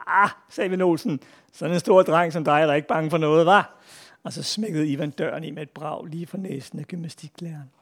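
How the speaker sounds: put-on lively read-aloud for children; story narration. A narrator's reading. Author reads a schoolboy tall tale to an audience, animated storytelling. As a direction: tell a comic tale with animated character quotes.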